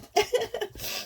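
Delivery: high sharp cough. Real, not acted. Coughing after the sneeze.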